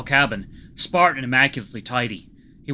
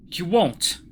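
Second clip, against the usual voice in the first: higher, firm assurance; 6 semitones higher than usual. A narrator's reading. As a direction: state it with sudden resolve. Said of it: "His voice was suddenly firm".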